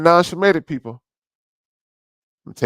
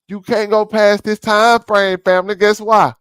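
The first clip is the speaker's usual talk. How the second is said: higher and louder, slowed, daring the listener; raised challenging voice; 8 semitones higher, 6 dB louder than usual. Real, not acted. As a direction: lean in and push each word out. Ranting at the audience.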